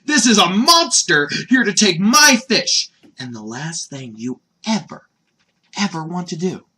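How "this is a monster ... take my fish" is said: high, loud mock outrage; hammed-up alarm at a monster; Goku, alarmed. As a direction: cry out to defend your catch. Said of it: "his first reaction is".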